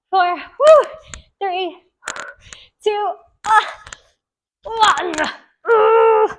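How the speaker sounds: out of breath, straining; after a workout. Real, not acted. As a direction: gasp through the last reps. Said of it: "legs are burning".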